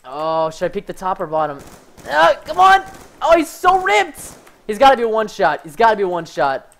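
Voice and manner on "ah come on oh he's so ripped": high, loud exasperated yell; frustrated outcry. Real, not acted. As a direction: cry out in exasperation at the game. Frustrated by enemy in game.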